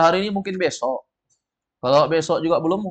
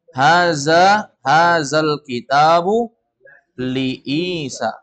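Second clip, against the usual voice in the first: very slow "hazal kitabu", then "li Isa" settling down; corrected intonation; 4 dB louder, much slower than usual. Shown as a demonstration. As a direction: model "Haza, hazal kitabu li Isa" deliberately as a statement. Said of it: "Intonasinya agak dirubah". ("li Isa" pelan dan turun)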